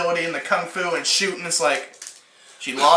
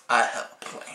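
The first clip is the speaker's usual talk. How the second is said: hushed, whispered and softer; dramatic whisper; 6 semitones lower, 7 dB quieter than usual. Retold or imitated. Alice telling the group her plan, hushed mock-dramatic whisper. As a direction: breathe it as a secret, mock-serious. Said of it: "And Alice is like".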